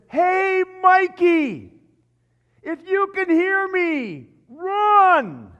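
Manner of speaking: high, full-force drawn-out yell; Chunk's yell. Retold or imitated. Preacher acts Chunk calling to Mikey, high loud desperate yell. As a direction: scream it out to a friend far away. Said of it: "Chunk runs over and he says".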